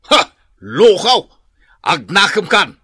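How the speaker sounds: loud shouted insult; angry shout. Acted. Old father yelling at his lazy son. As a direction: yell it at him in fury. Parent scolds son asking loan.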